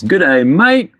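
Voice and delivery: high-pitched mock Australian greeting; attempted Australian accent. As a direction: put on a hammy Australian accent. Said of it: "trying to talk like an Australian".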